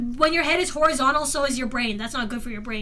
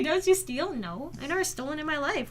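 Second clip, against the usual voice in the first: ordinary talk, nothing marked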